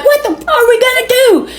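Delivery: very high, frantic mock yell; mock screaming. Ranting passenger to girl driver out of gas, mock screaming. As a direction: squeal it in exasperation without much volume.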